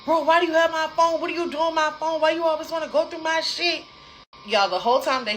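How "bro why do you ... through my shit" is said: high, loud, rapid-fire outburst; mock screaming. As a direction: imitate a boyfriend going off in a panic. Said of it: "He starts screaming".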